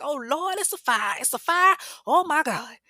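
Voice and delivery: high, loud panicked oh Lord; realizing the house is burning; alarmed cries. Retold or imitated. The neighbor spotting the fire, loud and panicked. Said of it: "She was like".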